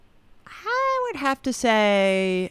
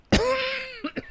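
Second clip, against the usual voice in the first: sharp cough from chili heat; coughing fit; 4 dB quieter than usual. Real, not acted. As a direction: cough as the spice catches your throat. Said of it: "She's coughing".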